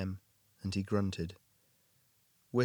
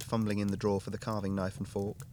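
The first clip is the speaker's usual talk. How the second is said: plain reading voice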